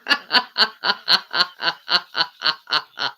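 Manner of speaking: long staccato laugh in even pulses; real chuckle. Real, not acted. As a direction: let a steady ha-ha-ha run on. Laughing at own touching-up joke.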